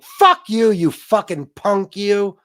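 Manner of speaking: shouted insult, slow and loud; mock angry yell. Imitating the host kicking him off, angry yell. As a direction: yell it like she's furious at him. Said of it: "She was mad".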